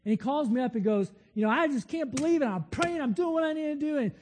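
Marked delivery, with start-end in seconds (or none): 1.3-4.0 higher, faster imitation of an exasperated friend; frustrated friend. Preacher retelling his friend blaming God on the phone, a fast pitched-up exasperated complaint.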